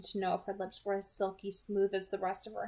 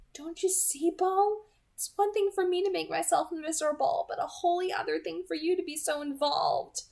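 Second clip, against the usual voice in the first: put-on higher, louder voice of an anguished vampire girl; anguished Edith; 9 semitones higher, 4 dB louder than usual. A narrator's reading. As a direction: plead in pain, raised and tense.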